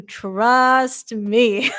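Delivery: ordinary talk, nothing marked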